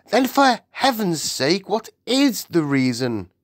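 high exasperated demand; impatient Queen. A narrator's reading. The Queen demands the reason from her maid, impatient.

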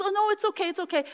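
high, polite brush-off, lightly voiced; a hint of a startled older woman declining. Older woman at Costco, mildly startled refusal. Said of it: "She goes".

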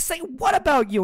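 retold outraged question; indignant surprise. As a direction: demand it in disbelief. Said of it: "And I'm like".

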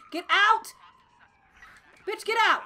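loud, high order; demanding shout. Real, not acted. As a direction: yell it as an impatient command. Ordering driver out of car.

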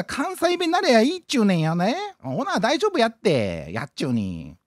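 fake Kansai dialect. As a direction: ham up a fake Osaka Kansai accent.

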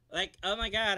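normal talking voice

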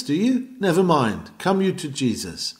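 normal talking voice

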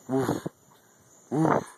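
short imitated woof; mock dog bark. Owner barking at his own dog, mock woof.

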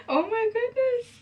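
very high, slow squeal; drawn-out high-pitched awe; thrilled amazement. Real, not acted. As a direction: squeak it out slowly, overcome with delight. Repeats reaction, overcome by palette.

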